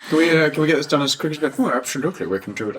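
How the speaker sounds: put-on quick half-hearted Belgian accent; fast mangled Belgian accent; attempted Belgian accent. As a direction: rattle it off in a vague Belgian accent. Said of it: "I don't know what that accent is".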